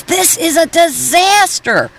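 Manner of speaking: put-on high, slow despairing child voice; high, slow mock-tragic boy; dramatic kid voice. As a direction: wail it like a boy whose show is lost. Son voiced in comic reading.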